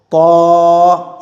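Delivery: "पौ" as a heavy emphatic T with long rounded vowel; thick-sound demo. Shown as a demonstration. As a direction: hold "पौ" full and level. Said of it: "इस तरह से". ("पौ" को मोटा करके खींचें)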